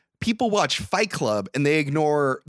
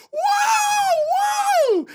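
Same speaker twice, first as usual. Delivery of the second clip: long, very high party whoop; cheering woo; 3 dB louder than usual. Retold or imitated. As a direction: keep whooping like an excited crowd of girls. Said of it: "girls in the room are still going like".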